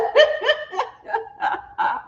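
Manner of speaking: high laugh trailing down. Real, not acted. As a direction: laugh at yourself, tailing off. Laughs at kids' teasing.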